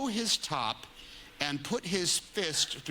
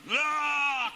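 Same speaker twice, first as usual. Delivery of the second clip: mock shout; 14 semitones higher, 4 dB louder than usual. Acting out punch.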